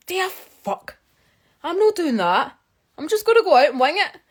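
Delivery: high-pitched mock outrage; incredulous refusal. Vlogger reliving her refusal of advice to sound professional, incredulous.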